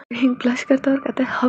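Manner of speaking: plain reading voice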